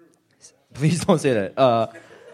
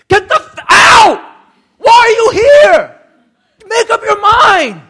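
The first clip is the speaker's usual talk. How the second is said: furious shouting at the roommates, much higher and louder; screamed outburst, slow on the last lines; retold angry yell; 19 dB louder, slower than usual. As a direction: blow up at the people walking in and out. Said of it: "I get so angry".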